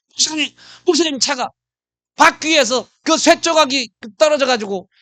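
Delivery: high and loud, rushing with alarm; urgent report. Retold or imitated. Evangelist rushing to tell the pastor, high and loud, alarmed. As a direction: hurry to tell him his car broke yours.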